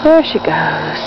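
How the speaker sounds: whisper. Real, not acted. Owner hushed, watching her dog run off. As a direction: whisper it as she runs off.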